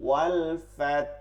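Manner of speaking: ওয়াল ফাত slow, a faint breathy h after ফা; slight h sound. Shown as a demonstration. Teacher showing students the hidden h in ওয়াল ফাত. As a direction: stretch ওয়াল ফাত and let a light হা ring at the end. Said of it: "একটু হা উচ্চারণ করব".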